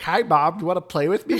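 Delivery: put-on pestering kid asking mom to play; eager child. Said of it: "he's like".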